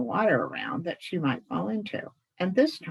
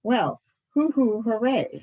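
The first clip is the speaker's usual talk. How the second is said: louder, higher mock hoot-hooray; playful shout; 6 semitones higher, 4 dB louder than usual. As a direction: shout back a mock owl cheer. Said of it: "shouted Scraps".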